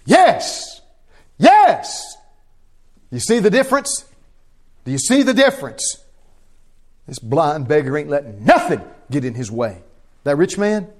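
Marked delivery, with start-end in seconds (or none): 0.0-6.0 high loud exhortation; preaching at full force. Real, not acted. Preacher pressing the congregation, full force. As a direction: drive the point home.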